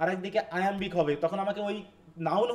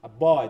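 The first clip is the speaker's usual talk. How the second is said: আ, বয় with আ light and quick and বয় hit louder; weak আ, then punch বয়; stress on boy; 5 semitones lower, 9 dB louder, slower than usual. Shown as a demonstration. Teacher demonstrating the stress of আ, বয় to the class, weak article then stressed বয়. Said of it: "দেখো, আ উচ্চারণ হচ্ছে না".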